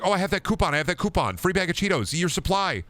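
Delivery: put-on high, eager kid voice retelling finding the coupon; excited kid. Remembering the gas station stop, a kid eagerly pipes up. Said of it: "I was like".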